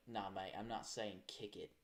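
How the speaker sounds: low, loosely British mate voice; muddled British accent. Retold or imitated. A Brit refusing the script line, muddled British accent. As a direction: have a vague go at a British accent. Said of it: "I don't know what accent I just did there".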